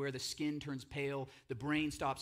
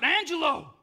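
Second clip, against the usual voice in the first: crying out; 13 semitones higher, 13 dB louder than usual. Acted. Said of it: "He cries out".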